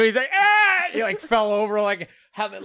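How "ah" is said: high, loud mock cry of pain; pained yelp. Brother hit by a golf cart, mock pained yelp. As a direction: yelp like you just got hit.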